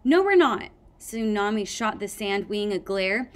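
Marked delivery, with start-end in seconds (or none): 0.0-0.7 loud, high defiant retort. A narrator's reading. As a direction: fire it back, defiant.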